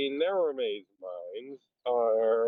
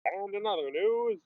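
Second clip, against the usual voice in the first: newsreel announcer voice; a hint of a forties newscaster; old newsreel; 8 semitones higher than usual. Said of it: "you sound like a nineteen forties newsreel".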